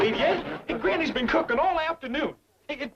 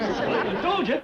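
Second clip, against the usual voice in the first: usual speaking voice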